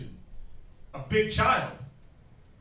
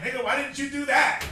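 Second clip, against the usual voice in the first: mock nagging demand; 5 semitones higher, 6 dB louder than usual. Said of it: "It's like".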